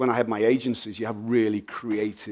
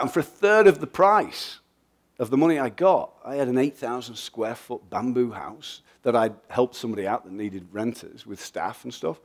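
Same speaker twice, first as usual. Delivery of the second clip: usual speaking voice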